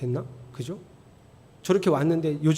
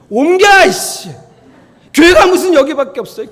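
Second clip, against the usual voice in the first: high, loud, mock-angry blurt; exasperated shout, acted out; acted indignant outburst; 9 semitones higher, 13 dB louder than usual. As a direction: play a snubbed newcomer storming off. Acts a snubbed member's outburst.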